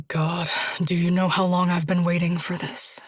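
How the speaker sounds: low husky whisper. A narrator's reading. Josh to Julia, husky whisper. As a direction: breathe it low with longing. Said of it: "in that husky voice".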